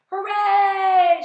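long, high, loud cheer; jubilant shout. A narrator's reading. Grasshopper cheering the captured seagull, shouting. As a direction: cheer at the top of your voice. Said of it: "shouted the green grasshopper".

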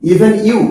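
even you hit hard to show the emphasis; stress on even you. Shown as a demonstration. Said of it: "তুমিওর উপরে আমরা জোর দিচ্ছি".

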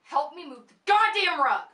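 loud, impatient shouted command; angry order. Acted. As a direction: bark the order at him. Ordering brother, yelling.

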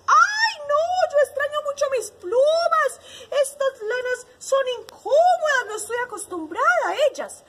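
high, loud wail of complaint; complaining hen. A narrator's reading. Hen telling the bee she misses feathers, wailing. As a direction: whine like an uncomfortable storybook hen. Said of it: "la gallina decía".

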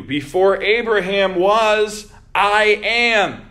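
booming pulpit declaration; proclaimed loud. Acted. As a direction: thunder the climax of the quote. Climax of the quoted declaration.

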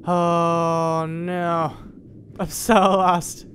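long, drawn-out wail of being lost; mock despair. Player stuck in an underwater cavern, slow mock-despairing wail. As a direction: draw the words out in hammed-up misery.

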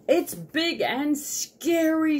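high, loud, drawn-out squirrel wail; frightened squirrel. Acted. Squirrel describing the danger to Sugar, frightened. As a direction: stretch it out in high-pitched alarm. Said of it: "said the squirrel".

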